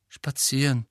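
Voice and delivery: ordinary delivery, nothing marked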